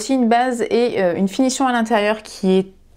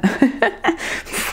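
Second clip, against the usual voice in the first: laugh; 3 semitones higher than usual. Real, not acted. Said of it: "Quel accent de merde".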